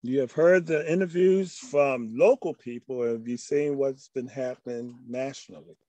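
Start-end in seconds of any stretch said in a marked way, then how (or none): none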